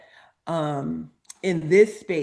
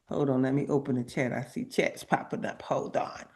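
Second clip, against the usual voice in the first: muttered aside; 6 semitones lower, 7 dB quieter, faster than usual. Real, not acted. Aside while opening chat.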